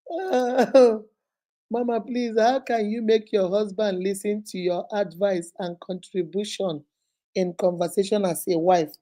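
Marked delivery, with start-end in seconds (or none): none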